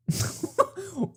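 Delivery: soft, high breathy giggle; quiet high giggle. Real, not acted. Reading the blush reply, a soft high giggle. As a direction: giggle under your breath.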